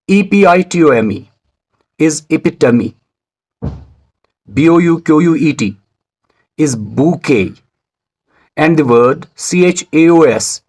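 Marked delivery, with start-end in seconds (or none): 2.3-3.0 epitome in four syllables, "i-PIT-uh-mee"; pronunciation model. Shown as a demonstration. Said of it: "guess the pronunciation of the words". 6.7-7.5 bouquet as "boo-KAY", silent T; pronunciation model. Shown as a demonstration. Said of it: "guess the pronunciation of the words".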